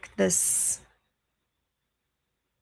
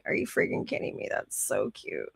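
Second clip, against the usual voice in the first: fast unvoiced whisper of disbelief; breathless whisper; 12 semitones lower, 7 dB quieter than usual. Real, not acted. Reacting to a comment, fast whispered disbelief. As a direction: hiss it out in quick disbelief.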